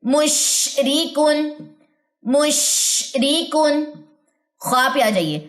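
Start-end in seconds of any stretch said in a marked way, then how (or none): 0.0-3.8 मुशरीकुन said very slowly with a breathy श; मुशरीकुन recited slowly for students; slow recitation demo. Shown as a demonstration. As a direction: draw out मुशरीकुन, letting the breath run through श. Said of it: "सांस हथेली को लगनी चाहिए".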